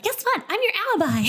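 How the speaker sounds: high-pitched mock excitement; excited. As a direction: squeal the news in imitation. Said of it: "she's so excited".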